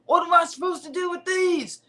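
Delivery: high, whining mock drunk; drunk heckler. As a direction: imitate a heckler calling out in protest. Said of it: "And he's like".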